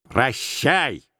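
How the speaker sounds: drawn-out goodbye in a put-on voice; stubbornly cutting off the nephew; холодное прощание. A narrator's reading. Scrooge dismisses him once more. (упрямо выпроваживая)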